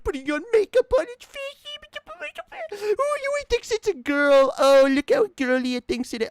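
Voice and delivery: mock the people who jeer at her clothes, high and nasty; sneering bigot. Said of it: "Some people look at me and say".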